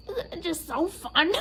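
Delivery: high, giggly delighted mom; imitating mom. Imitating mom in the car wash, high giggly. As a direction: gush like a happy child. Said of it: "she's like".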